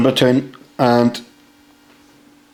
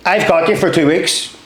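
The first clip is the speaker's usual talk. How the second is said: higher-pitched imitation Scottish corporal; Scottish accent; 5 semitones higher than usual. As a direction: question the recruit in put-on Scottish. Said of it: "he goes in a Scottish accent".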